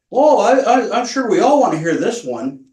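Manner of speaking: mocking high, loud sing-song imitation; condescending. Officer mocking a resident who asked for his counselor, condescending. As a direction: imitate him talking down to you. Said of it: "Very condescending".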